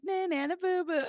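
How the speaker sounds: high sing-song teasing; childish taunt. Retold or imitated. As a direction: tease like a schoolyard kid. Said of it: "giving me the".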